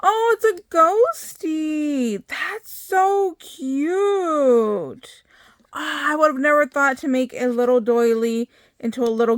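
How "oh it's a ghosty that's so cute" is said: cooing. Real, not acted. Cooing over a ghost doily.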